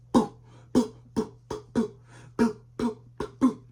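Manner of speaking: loud mocking boos in a high sing-song; loud booing chant. Reader boos the sign loudly, mocking chant. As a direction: boo them loudly, high and taunting.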